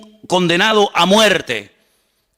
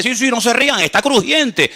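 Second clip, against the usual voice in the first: mock insistence; 6 semitones higher than usual.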